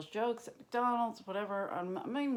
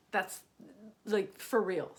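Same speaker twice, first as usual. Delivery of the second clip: normal talking voice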